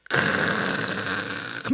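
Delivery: long rasping throat noise; voiceless guttural "kkh"; phlegmy throat rattle. Shown as a demonstration. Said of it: "가리 끓는 소리 해도 돼".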